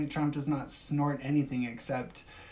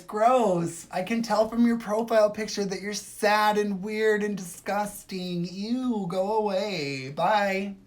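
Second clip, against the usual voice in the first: higher, louder, bratty brush-off; snooty mock disgust; 5 semitones higher, 4 dB louder, slower than usual. Streamer brushing off a hater in a bratty voice. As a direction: sneer the commenter away like a mean girl. Mocking a commenter.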